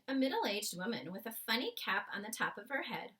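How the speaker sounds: plain reading voice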